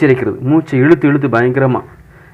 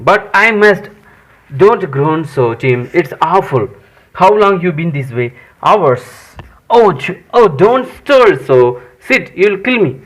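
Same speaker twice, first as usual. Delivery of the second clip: slow, higher-pitched staged dialogue; story dialogue read-aloud; 4 semitones higher, much slower than usual. Acted. Sid frets while Tom feigns pain, slow staged read-aloud. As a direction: act the exchange out word by word.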